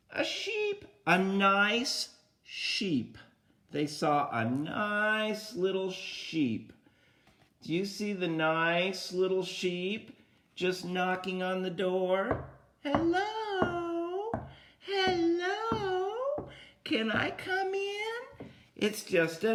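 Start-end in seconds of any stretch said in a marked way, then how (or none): 0.1-3.2 high, slow sweet voice; sweet sheep. Acted. Storyteller introducing the disguised sheep to children, sweet high voice. 12.8-18.5 very high, bleating hello; bleating sheep. Acted. The sheep asking the pigs to come in, high bleating.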